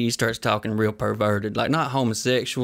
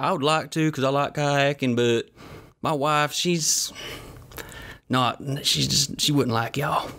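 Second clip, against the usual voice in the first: pitched up, hesitant excuse; gym dude voice; 4 semitones higher, slower than usual. Retold or imitated. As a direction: awkwardly explain the wife.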